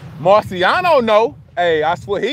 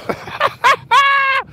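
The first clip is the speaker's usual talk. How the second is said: high laugh. Real, not acted. Laughing at his own line about lying.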